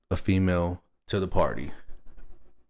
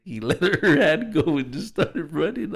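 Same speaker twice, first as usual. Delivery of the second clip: laughing; 9 semitones higher than usual. Real, not acted. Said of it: "I'm laughing so hard".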